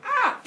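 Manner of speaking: short high, loud shriek; mock horrified scream. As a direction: yelp in cartoon horror. Hero sees his slain family.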